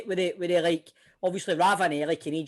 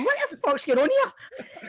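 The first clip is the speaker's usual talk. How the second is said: bewildered; 12 semitones higher, slower than usual. Retold or imitated. Mock bewildered player thinking.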